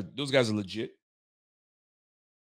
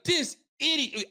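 exasperated insult; 14 semitones higher, slower than usual. Retold or imitated. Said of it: "I was like".

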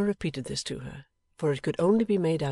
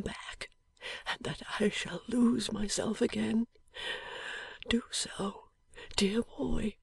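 barely voiced, dying breath; a dying man's faint whisper; 6 semitones higher, 4 dB quieter, slower than usual. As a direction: whisper it weakly from a sickbed.

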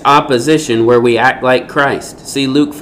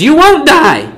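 much higher, mocking serpent voice; won't swung up high in a sly mock; mocking Satan voice; 5 dB louder than usual. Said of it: "Satan says".

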